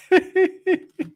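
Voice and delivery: high amused giggle; high-pitched burst of real laughter. Real, not acted. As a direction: giggle in a high, light run. Amused by a viewer's comment.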